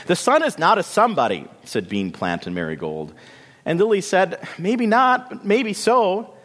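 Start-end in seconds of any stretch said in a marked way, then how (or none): 0.1-1.5 higher, fast, dismissive retort; scornful sisters. A narrator's reading. Two sisters correct Lily about the sun, scornful. 4.6-6.3 much higher, small-sister voice; stubborn little Lily. A narrator's reading. Lily answers her doubting sisters, stubbornly high-voiced.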